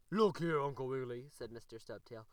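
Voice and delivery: loud, hearty bear voice; loud friendly bear. A narrator's reading. The bear gives the rabbit his pancakes, loud and hearty. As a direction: boom it out like a kindly bear.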